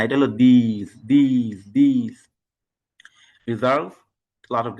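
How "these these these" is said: these said with a long ee and a buzzing z; saying these. Shown as a demonstration. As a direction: stretch these as theez, not this. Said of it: "এটা কিন্তু this না".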